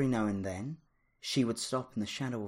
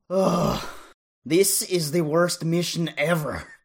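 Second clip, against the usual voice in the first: loud high complaining huff; huffing, out of breath; 8 semitones higher, 11 dB louder than usual. A narrator's reading. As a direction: whine loudly between breaths. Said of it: "huffed out of breath".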